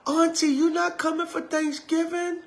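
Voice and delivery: put-on high child voice asking auntie; little niece. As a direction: pipe it up like a five-year-old. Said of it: "to your five-year-old niece".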